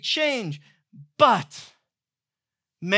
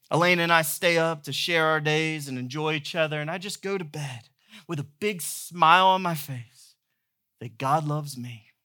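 ordinary talk

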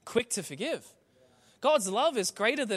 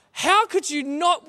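higher, louder, slow indignant question; outraged disbelief; 8 semitones higher, 11 dB louder, slower than usual. Retold or imitated. Friend offended on Mooloolaba's behalf, outraged. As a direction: demand it like you're personally offended. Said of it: "He was so mad at me".

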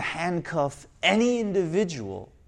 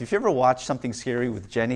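usual speaking voice

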